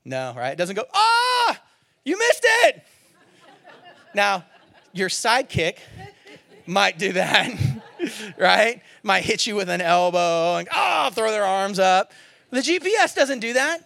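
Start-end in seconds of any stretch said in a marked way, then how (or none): 0.9-2.8 much higher and louder, exasperated; a panicking navigator voice; stressed-out GPS. Pretend. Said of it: "does the stress level of the voice go up". 10.7-11.1 higher, loud frustrated groan; exasperated sidekick. Pretend. Sidekick throws arms up.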